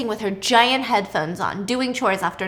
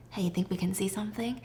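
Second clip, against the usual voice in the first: hushed, secretive unvoiced whisper; 4 semitones lower, 12 dB quieter, faster than usual. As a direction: whisper it as if the neighbors might hear. Peeking at the neighbors.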